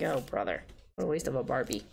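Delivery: low, weary mock groan; unimpressed reaction. Student reacting to friends burning a Barbie, low and unimpressed. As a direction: retell your disdain, pitched well down. Retells scornful reaction to classmates' plan.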